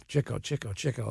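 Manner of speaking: soft repeat of "Chico" under his breath; muttering to himself. Retold or imitated. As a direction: quietly try the name out, as if practising a part. Rehearsing the name to himself.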